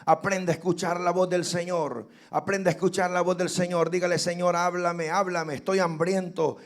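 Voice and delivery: loud, fervent altar-call preaching; full-force preaching. Acted. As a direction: drive the congregation into prayer, loud and urgent. Pastor calls church to pray.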